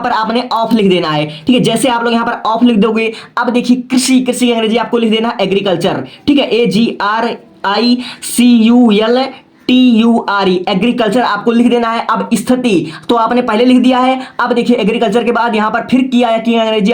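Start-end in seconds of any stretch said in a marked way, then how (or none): 6.5-10.5 slow, letter-by-letter spelling. Shown as a demonstration. Teacher spelling agriculture for students, slow letter by letter. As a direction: spell it slowly, one letter at a time.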